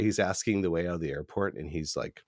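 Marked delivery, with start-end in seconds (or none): none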